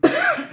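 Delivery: sharp loud cough. Real, not acted. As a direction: clear the throat hard. Coughs to family on camera.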